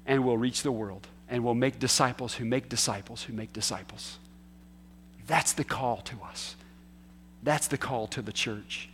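soft, low and solemn; hushed conviction. Acted. Preacher giving the church its call, hushed and solemn. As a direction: drop right down and let the charge land quietly.

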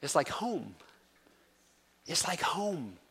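soft, hushed and heartfelt; tender. Real, not acted. Preacher telling the congregation how mother tongue feels, tender hush. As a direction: say it gently, almost to yourself. Savoring the feeling of home.

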